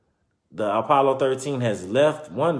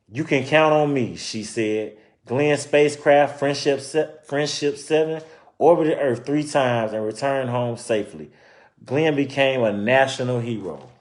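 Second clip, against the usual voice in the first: story narration; 3 semitones higher, 4 dB louder than usual. Acted. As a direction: clear read-aloud storytelling voice. Reading picture book aloud.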